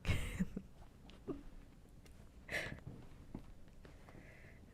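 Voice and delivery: breathy, hushed giggle. Real, not acted. Amused by the viewers' request.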